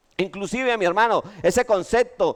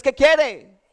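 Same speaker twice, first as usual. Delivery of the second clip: curt question; 8 semitones higher, 6 dB louder than usual. Retold or imitated. Waitress again asks his order.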